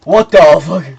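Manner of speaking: sudden loud outburst at the game; frustrated yell. Real, not acted. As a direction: shout it out in real rage. Gamer raging at the game.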